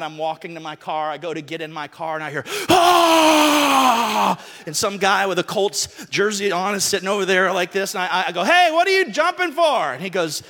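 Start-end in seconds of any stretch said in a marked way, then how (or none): 2.7-4.4 a loud, high "ah" of triumph; fan's yell. Retold or imitated. Acting out a Colts fan cheering in the parking lot, loud whoop. 8.4-9.9 loud and higher, shouting across the lot; calling out. Retold or imitated. Shouting to a Colts fan across the parking lot.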